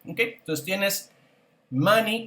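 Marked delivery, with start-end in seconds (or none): 1.7-2.3 money said slowly and clearly as mani; teacher shows how money sounds; model pronunciation. Shown as a demonstration. Teacher models the English word for students, clearly. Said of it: "que es la palabra dinero".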